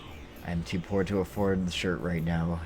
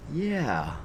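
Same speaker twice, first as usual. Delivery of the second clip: a higher, drawled American "Yeah"; American accent; 7 semitones higher than usual. Retold or imitated. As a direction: mimic the Japanese actor's American "Yeah". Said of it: "an American accent when he said".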